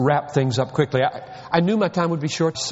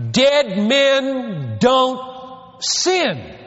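preached shout; 6 semitones higher, much slower than usual. Real, not acted. Preacher answers the objection.

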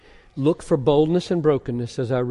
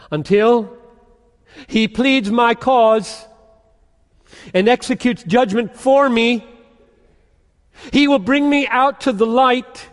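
higher and louder, ringing proclamation; preaching at full force; 9 semitones higher, 8 dB louder than usual. Acted. Preacher proclaiming Micah's hope to the church, full-force preaching. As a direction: thunder the verses out to the congregation.

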